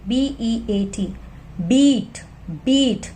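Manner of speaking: slow spelling, बीट with a long ई; long vowel demo. Shown as a demonstration. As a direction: spell slowly, stretch ई in बीट. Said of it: "ई की long sound".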